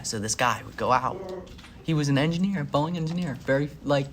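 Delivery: ordinary talk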